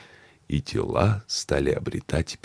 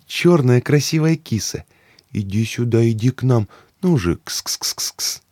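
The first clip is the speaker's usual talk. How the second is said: high, loud coaxing of a cat; excited coaxing; 8 semitones higher, 4 dB louder than usual. Acted. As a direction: call the kitty over eagerly.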